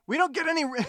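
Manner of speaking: ordinary talk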